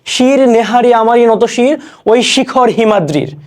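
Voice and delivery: slow, stately verse reading; slow declaimed poetry; poem recitation. Shown as a demonstration. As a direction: draw the line out with pride. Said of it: "তারপর দেখো".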